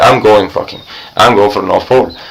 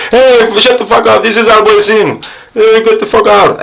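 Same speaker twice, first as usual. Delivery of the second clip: mock neighbor yell; 10 semitones higher than usual. Neighbors yelling at him.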